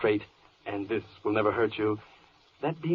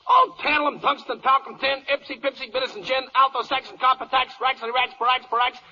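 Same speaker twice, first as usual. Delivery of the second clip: high, loud cheerleader chant of element names; hyped-up pep-rally yelling; football yell; 12 semitones higher, 5 dB louder, faster than usual. Acted. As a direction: rattle off the list like a stadium cheer. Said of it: "are these football yells necessary".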